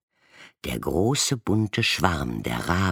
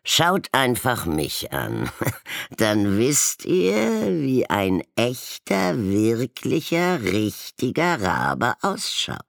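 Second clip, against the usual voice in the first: higher, louder croaking jab; croaking insult; 8 semitones higher, 3 dB louder than usual. A narrator's reading. Golden raven croaking an insult at the violet one. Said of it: "krächzte ein Goldgelber".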